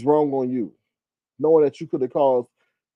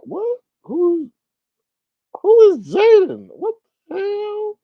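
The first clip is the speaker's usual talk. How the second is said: much higher, incredulous who is Jaden; shocked; 6 dB louder, slower than usual. Real, not acted. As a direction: shriek it in disbelief. Shocked by unknown name.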